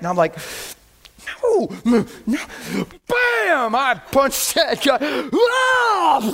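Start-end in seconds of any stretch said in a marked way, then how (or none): 1.4-2.4 loud, high protest; mock struggle, raised. Resisting the urge. 3.1-6.2 high, loud excited shout; shouted thrill of the blow. Retold or imitated. Acting out the punch.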